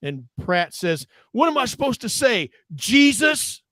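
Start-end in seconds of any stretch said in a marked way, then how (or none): 1.3-3.6 high-pitched incredulous retort, rising on Jesus; imitated derision. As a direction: act out a movie hero's scoffing comeback. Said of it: "It's always Jesus in derision".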